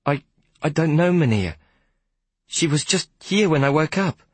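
put-on high, stammering small boy; boy nervously answering the conductor. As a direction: stumble over the words timidly.

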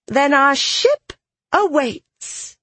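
high, drawn-out character voice; excited childlike captain. Acted. Marrow announces the waiting ship, high excited flourish. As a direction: announce it slowly in a high, eager voice.

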